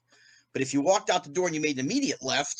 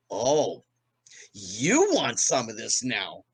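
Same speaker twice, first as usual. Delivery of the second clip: a hint of a slow, low comic challenge; drawn-out mock taunt; lightly voiced taunt; 5 semitones lower, much slower than usual. [name] taunting on stage.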